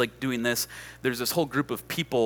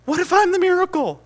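very high, loud proclamation; impassioned preacher's cry; 8 dB louder than usual. Retold or imitated. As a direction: cry it out as the sermon's climax. Line repeated as preaching climax.

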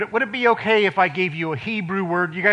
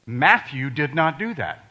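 ordinary talk

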